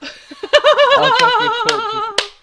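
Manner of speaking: high, slow, laughing through words; laughing hard. Real, not acted. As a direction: squeal it out while laughing. Laughing imitation of player.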